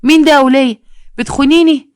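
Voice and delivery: "مين ده يا ولية" بصوت عالٍ ونبرة عالية; a jealous husband bursting in, yelling; angry shout. Acted. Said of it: "قال بغضب". (صراخ زوج غاضب)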